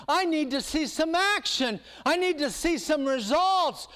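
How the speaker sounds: demanding. Acted. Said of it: "he's trying to say".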